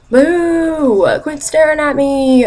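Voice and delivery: high, drawn-out boo and complaint; mock heckle. Acts out blunt reaction to staring.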